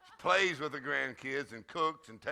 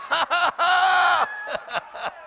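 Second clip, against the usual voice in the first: high, slow, drawn-out "ha, ha, ha"; deliberate exultant laugh; 13 semitones higher, 11 dB louder, much slower than usual. Shown as a demonstration. Preacher rejoicing before the congregation, deliberate exultant laugh. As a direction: stretch out a shouted laugh. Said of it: "Practice laughing for a few minutes".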